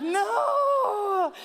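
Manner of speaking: high, loud anguished no; horrified cry. Retold or imitated. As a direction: cry out in mock horror. Mother horrified at son.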